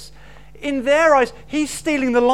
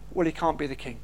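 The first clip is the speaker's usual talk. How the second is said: dismissive verdict; 8 semitones lower, 5 dB quieter, faster than usual. Retold or imitated. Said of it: "they say".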